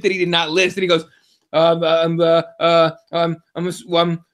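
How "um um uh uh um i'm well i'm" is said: halting string of ums and uhs; imitated floundering. Fighter questioned about supplements, mock flustered stammering. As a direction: stall and stumble for an answer.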